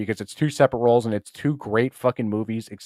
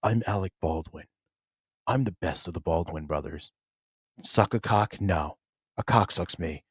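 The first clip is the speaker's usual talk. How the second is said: low, hushed, self-important star; mock a smug actor in a low, quiet voice; low, measured mocking impersonation; Alec Baldwin; 5 semitones lower, 7 dB quieter than usual. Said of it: "He just talks like this".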